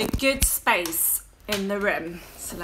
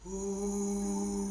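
low grandad voice; 4 semitones lower, 7 dB quieter than usual. Shown as a demonstration. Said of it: "sound like Grandad's voice".

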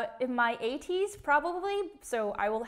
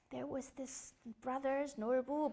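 much softer bedtime-story reading; soft storytelling grandma; 11 dB quieter than usual. Retold or imitated. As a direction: read it soft and lulling like a grandma. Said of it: "grandmothers would read the story like".

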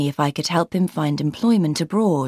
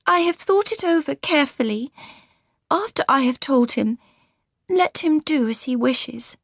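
put-on higher, gentle young woman's voice; Laura's voice; 8 semitones higher than usual. A narrator's reading. Laura greeting her sister warmly, higher voice. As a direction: lift into the young bride's voice.

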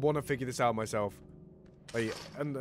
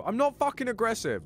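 indignant; 9 semitones higher, 5 dB louder than usual. Real, not acted. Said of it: "[name] is aggressive".

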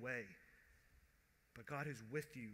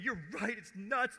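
loud, pitched-up validation; impassioned, raised assent; emphatic agreement; 6 semitones higher, 6 dB louder than usual. Acted. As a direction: agree hotly and loudly. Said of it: "Jesus is going".

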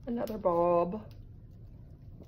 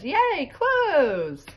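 excited crayon; 10 semitones higher, 6 dB louder than usual. Acted. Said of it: "Peach Crayon says".

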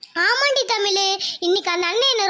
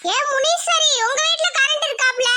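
higher call across to the neighbour; 5 semitones higher than usual. Acted. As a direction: holler to the neighbour's house, pitched up. Mother calls out to neighbour.

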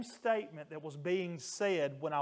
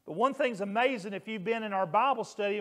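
ordinary talk

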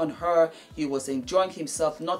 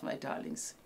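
drops to a soft whisper, much lower and softer; whispered sign-off; 13 semitones lower, 10 dB quieter than usual. Real, not acted. Signing off to her darlings in a soft whisper. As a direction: breathe the endearment like a secret.

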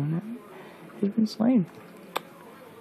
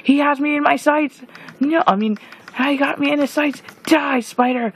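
frantic fight with a spider; excited shouting; 10 semitones higher than usual. Real, not acted. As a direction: yell through the fight.

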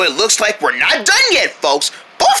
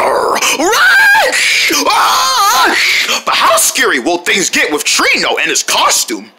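screaming in fright; 9 semitones higher, slower than usual. Acted. Kids flee a monster.

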